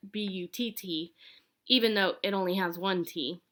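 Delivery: ordinary delivery, nothing marked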